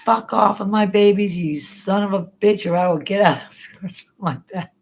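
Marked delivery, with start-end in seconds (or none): 0.0-3.4 higher, louder mock yell at the aliens; retold yell at the aliens. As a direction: snap at them to protect the babies. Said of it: "I said".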